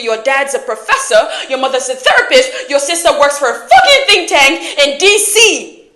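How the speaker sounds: loud list building in heat; scornful rant. Acted. Acted quarrel, listing his privileges.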